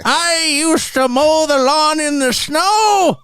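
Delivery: put-on high, slow cranky old geezer; creaky old-timer grumbling at kids; old prospector. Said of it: "we all sound like really, really old people".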